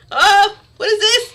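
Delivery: loud, high startled exclamation; surprised. Real, not acted. Streamer startled by new keyboard, surprised. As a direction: burst out in real surprise, pitch jumping up.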